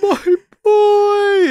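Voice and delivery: high, drawn-out wailing; hammed-up grief, very high and stretched; anguished father's wail. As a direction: wail it out like a heartbroken movie dad. Acted grieving dad in film scene.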